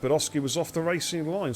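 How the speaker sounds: ordinary delivery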